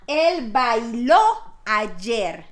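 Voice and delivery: teacher's slow demo, bailó stressed at the end; stress on the final O. Shown as a demonstration. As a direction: hit the last syllable of bailó. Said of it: "bailo y bailó se escriben de la misma forma".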